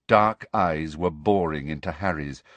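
usual speaking voice

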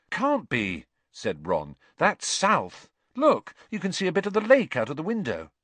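Ron voice. Acted.

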